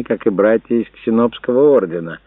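ordinary delivery, nothing marked

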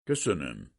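restrained, frosty thanks; icy. A narrator's reading. Officer answering the professor's confession, icy. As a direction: thank him coolly, without raising the voice.

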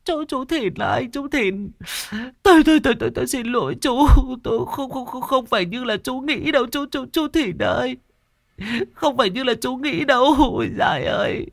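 high, stuttering, tearful begging; stammering old woman pleading. Acted. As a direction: plead for mercy, tripping over every word. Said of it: "mụ lắp bắp van xin". (giọng bà già lắp bắp van xin)

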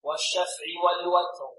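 slow, measured tajweed chant; Quran recitation. Shown as a demonstration. Teacher leads students in repeat-after-me recitation, slow tajweed chant. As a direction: intone it on a steady note with long vowels.

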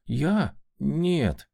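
mildly flustered, drawn-out answer; bewildered drawl. A narrator's reading. Baronet asked what he wanted, bewildered drawl. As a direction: stretch the words, caught off guard. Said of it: "растерянно протянул парень".

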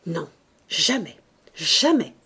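refus orgueilleux, plus fort sur le dernier mot; orgueil âpre. A narrator's reading. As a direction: nier âprement, en montant à la répétition. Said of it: "avec un orgueil âpre". (ton d'orgueil âpre)

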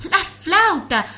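very high, loud alarm cry; shouting. Acted. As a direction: shout a warning in panic. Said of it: "avisó a los gritos la pulga".

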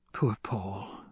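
soft breathy whisper; barely voiced, full of pity for her son. A narrator's reading. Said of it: "she whispered".

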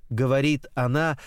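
plain reading voice

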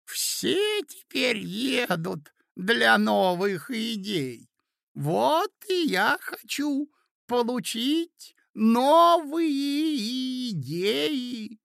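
put-on high, slow, drawn-out old man's voice; doddering old prince. A narrator's reading. Old prince telling the ladies his travel plans, doddering. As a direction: stretch each word out in a high pitch.

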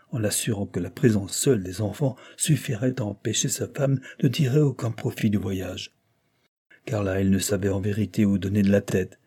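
usual speaking voice

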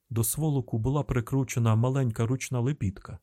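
usual speaking voice

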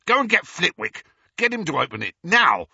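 high, loud angry orders; barking orders. A narrator's reading. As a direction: bark the command. Amycus ordering angrily.

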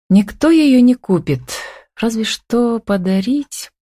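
high-pitched, resigned remark; sighing. A narrator's reading. Tanya giving up on selling the factory, sighing. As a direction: trail into a slow, mild sigh. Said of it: "снова вздохнула она".

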